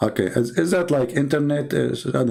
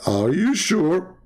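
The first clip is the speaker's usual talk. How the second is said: higher, very drawn-out doubting question; teasing insistence; 5 semitones higher, much slower than usual. Retold or imitated. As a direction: stretch every word to needle him, pitch climbing. Retold needling question, repeated.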